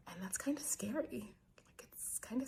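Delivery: soft whispered admission; whispering. Real, not acted. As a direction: breathe it out quietly. Admitting fear, kids asleep.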